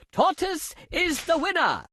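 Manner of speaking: plain reading voice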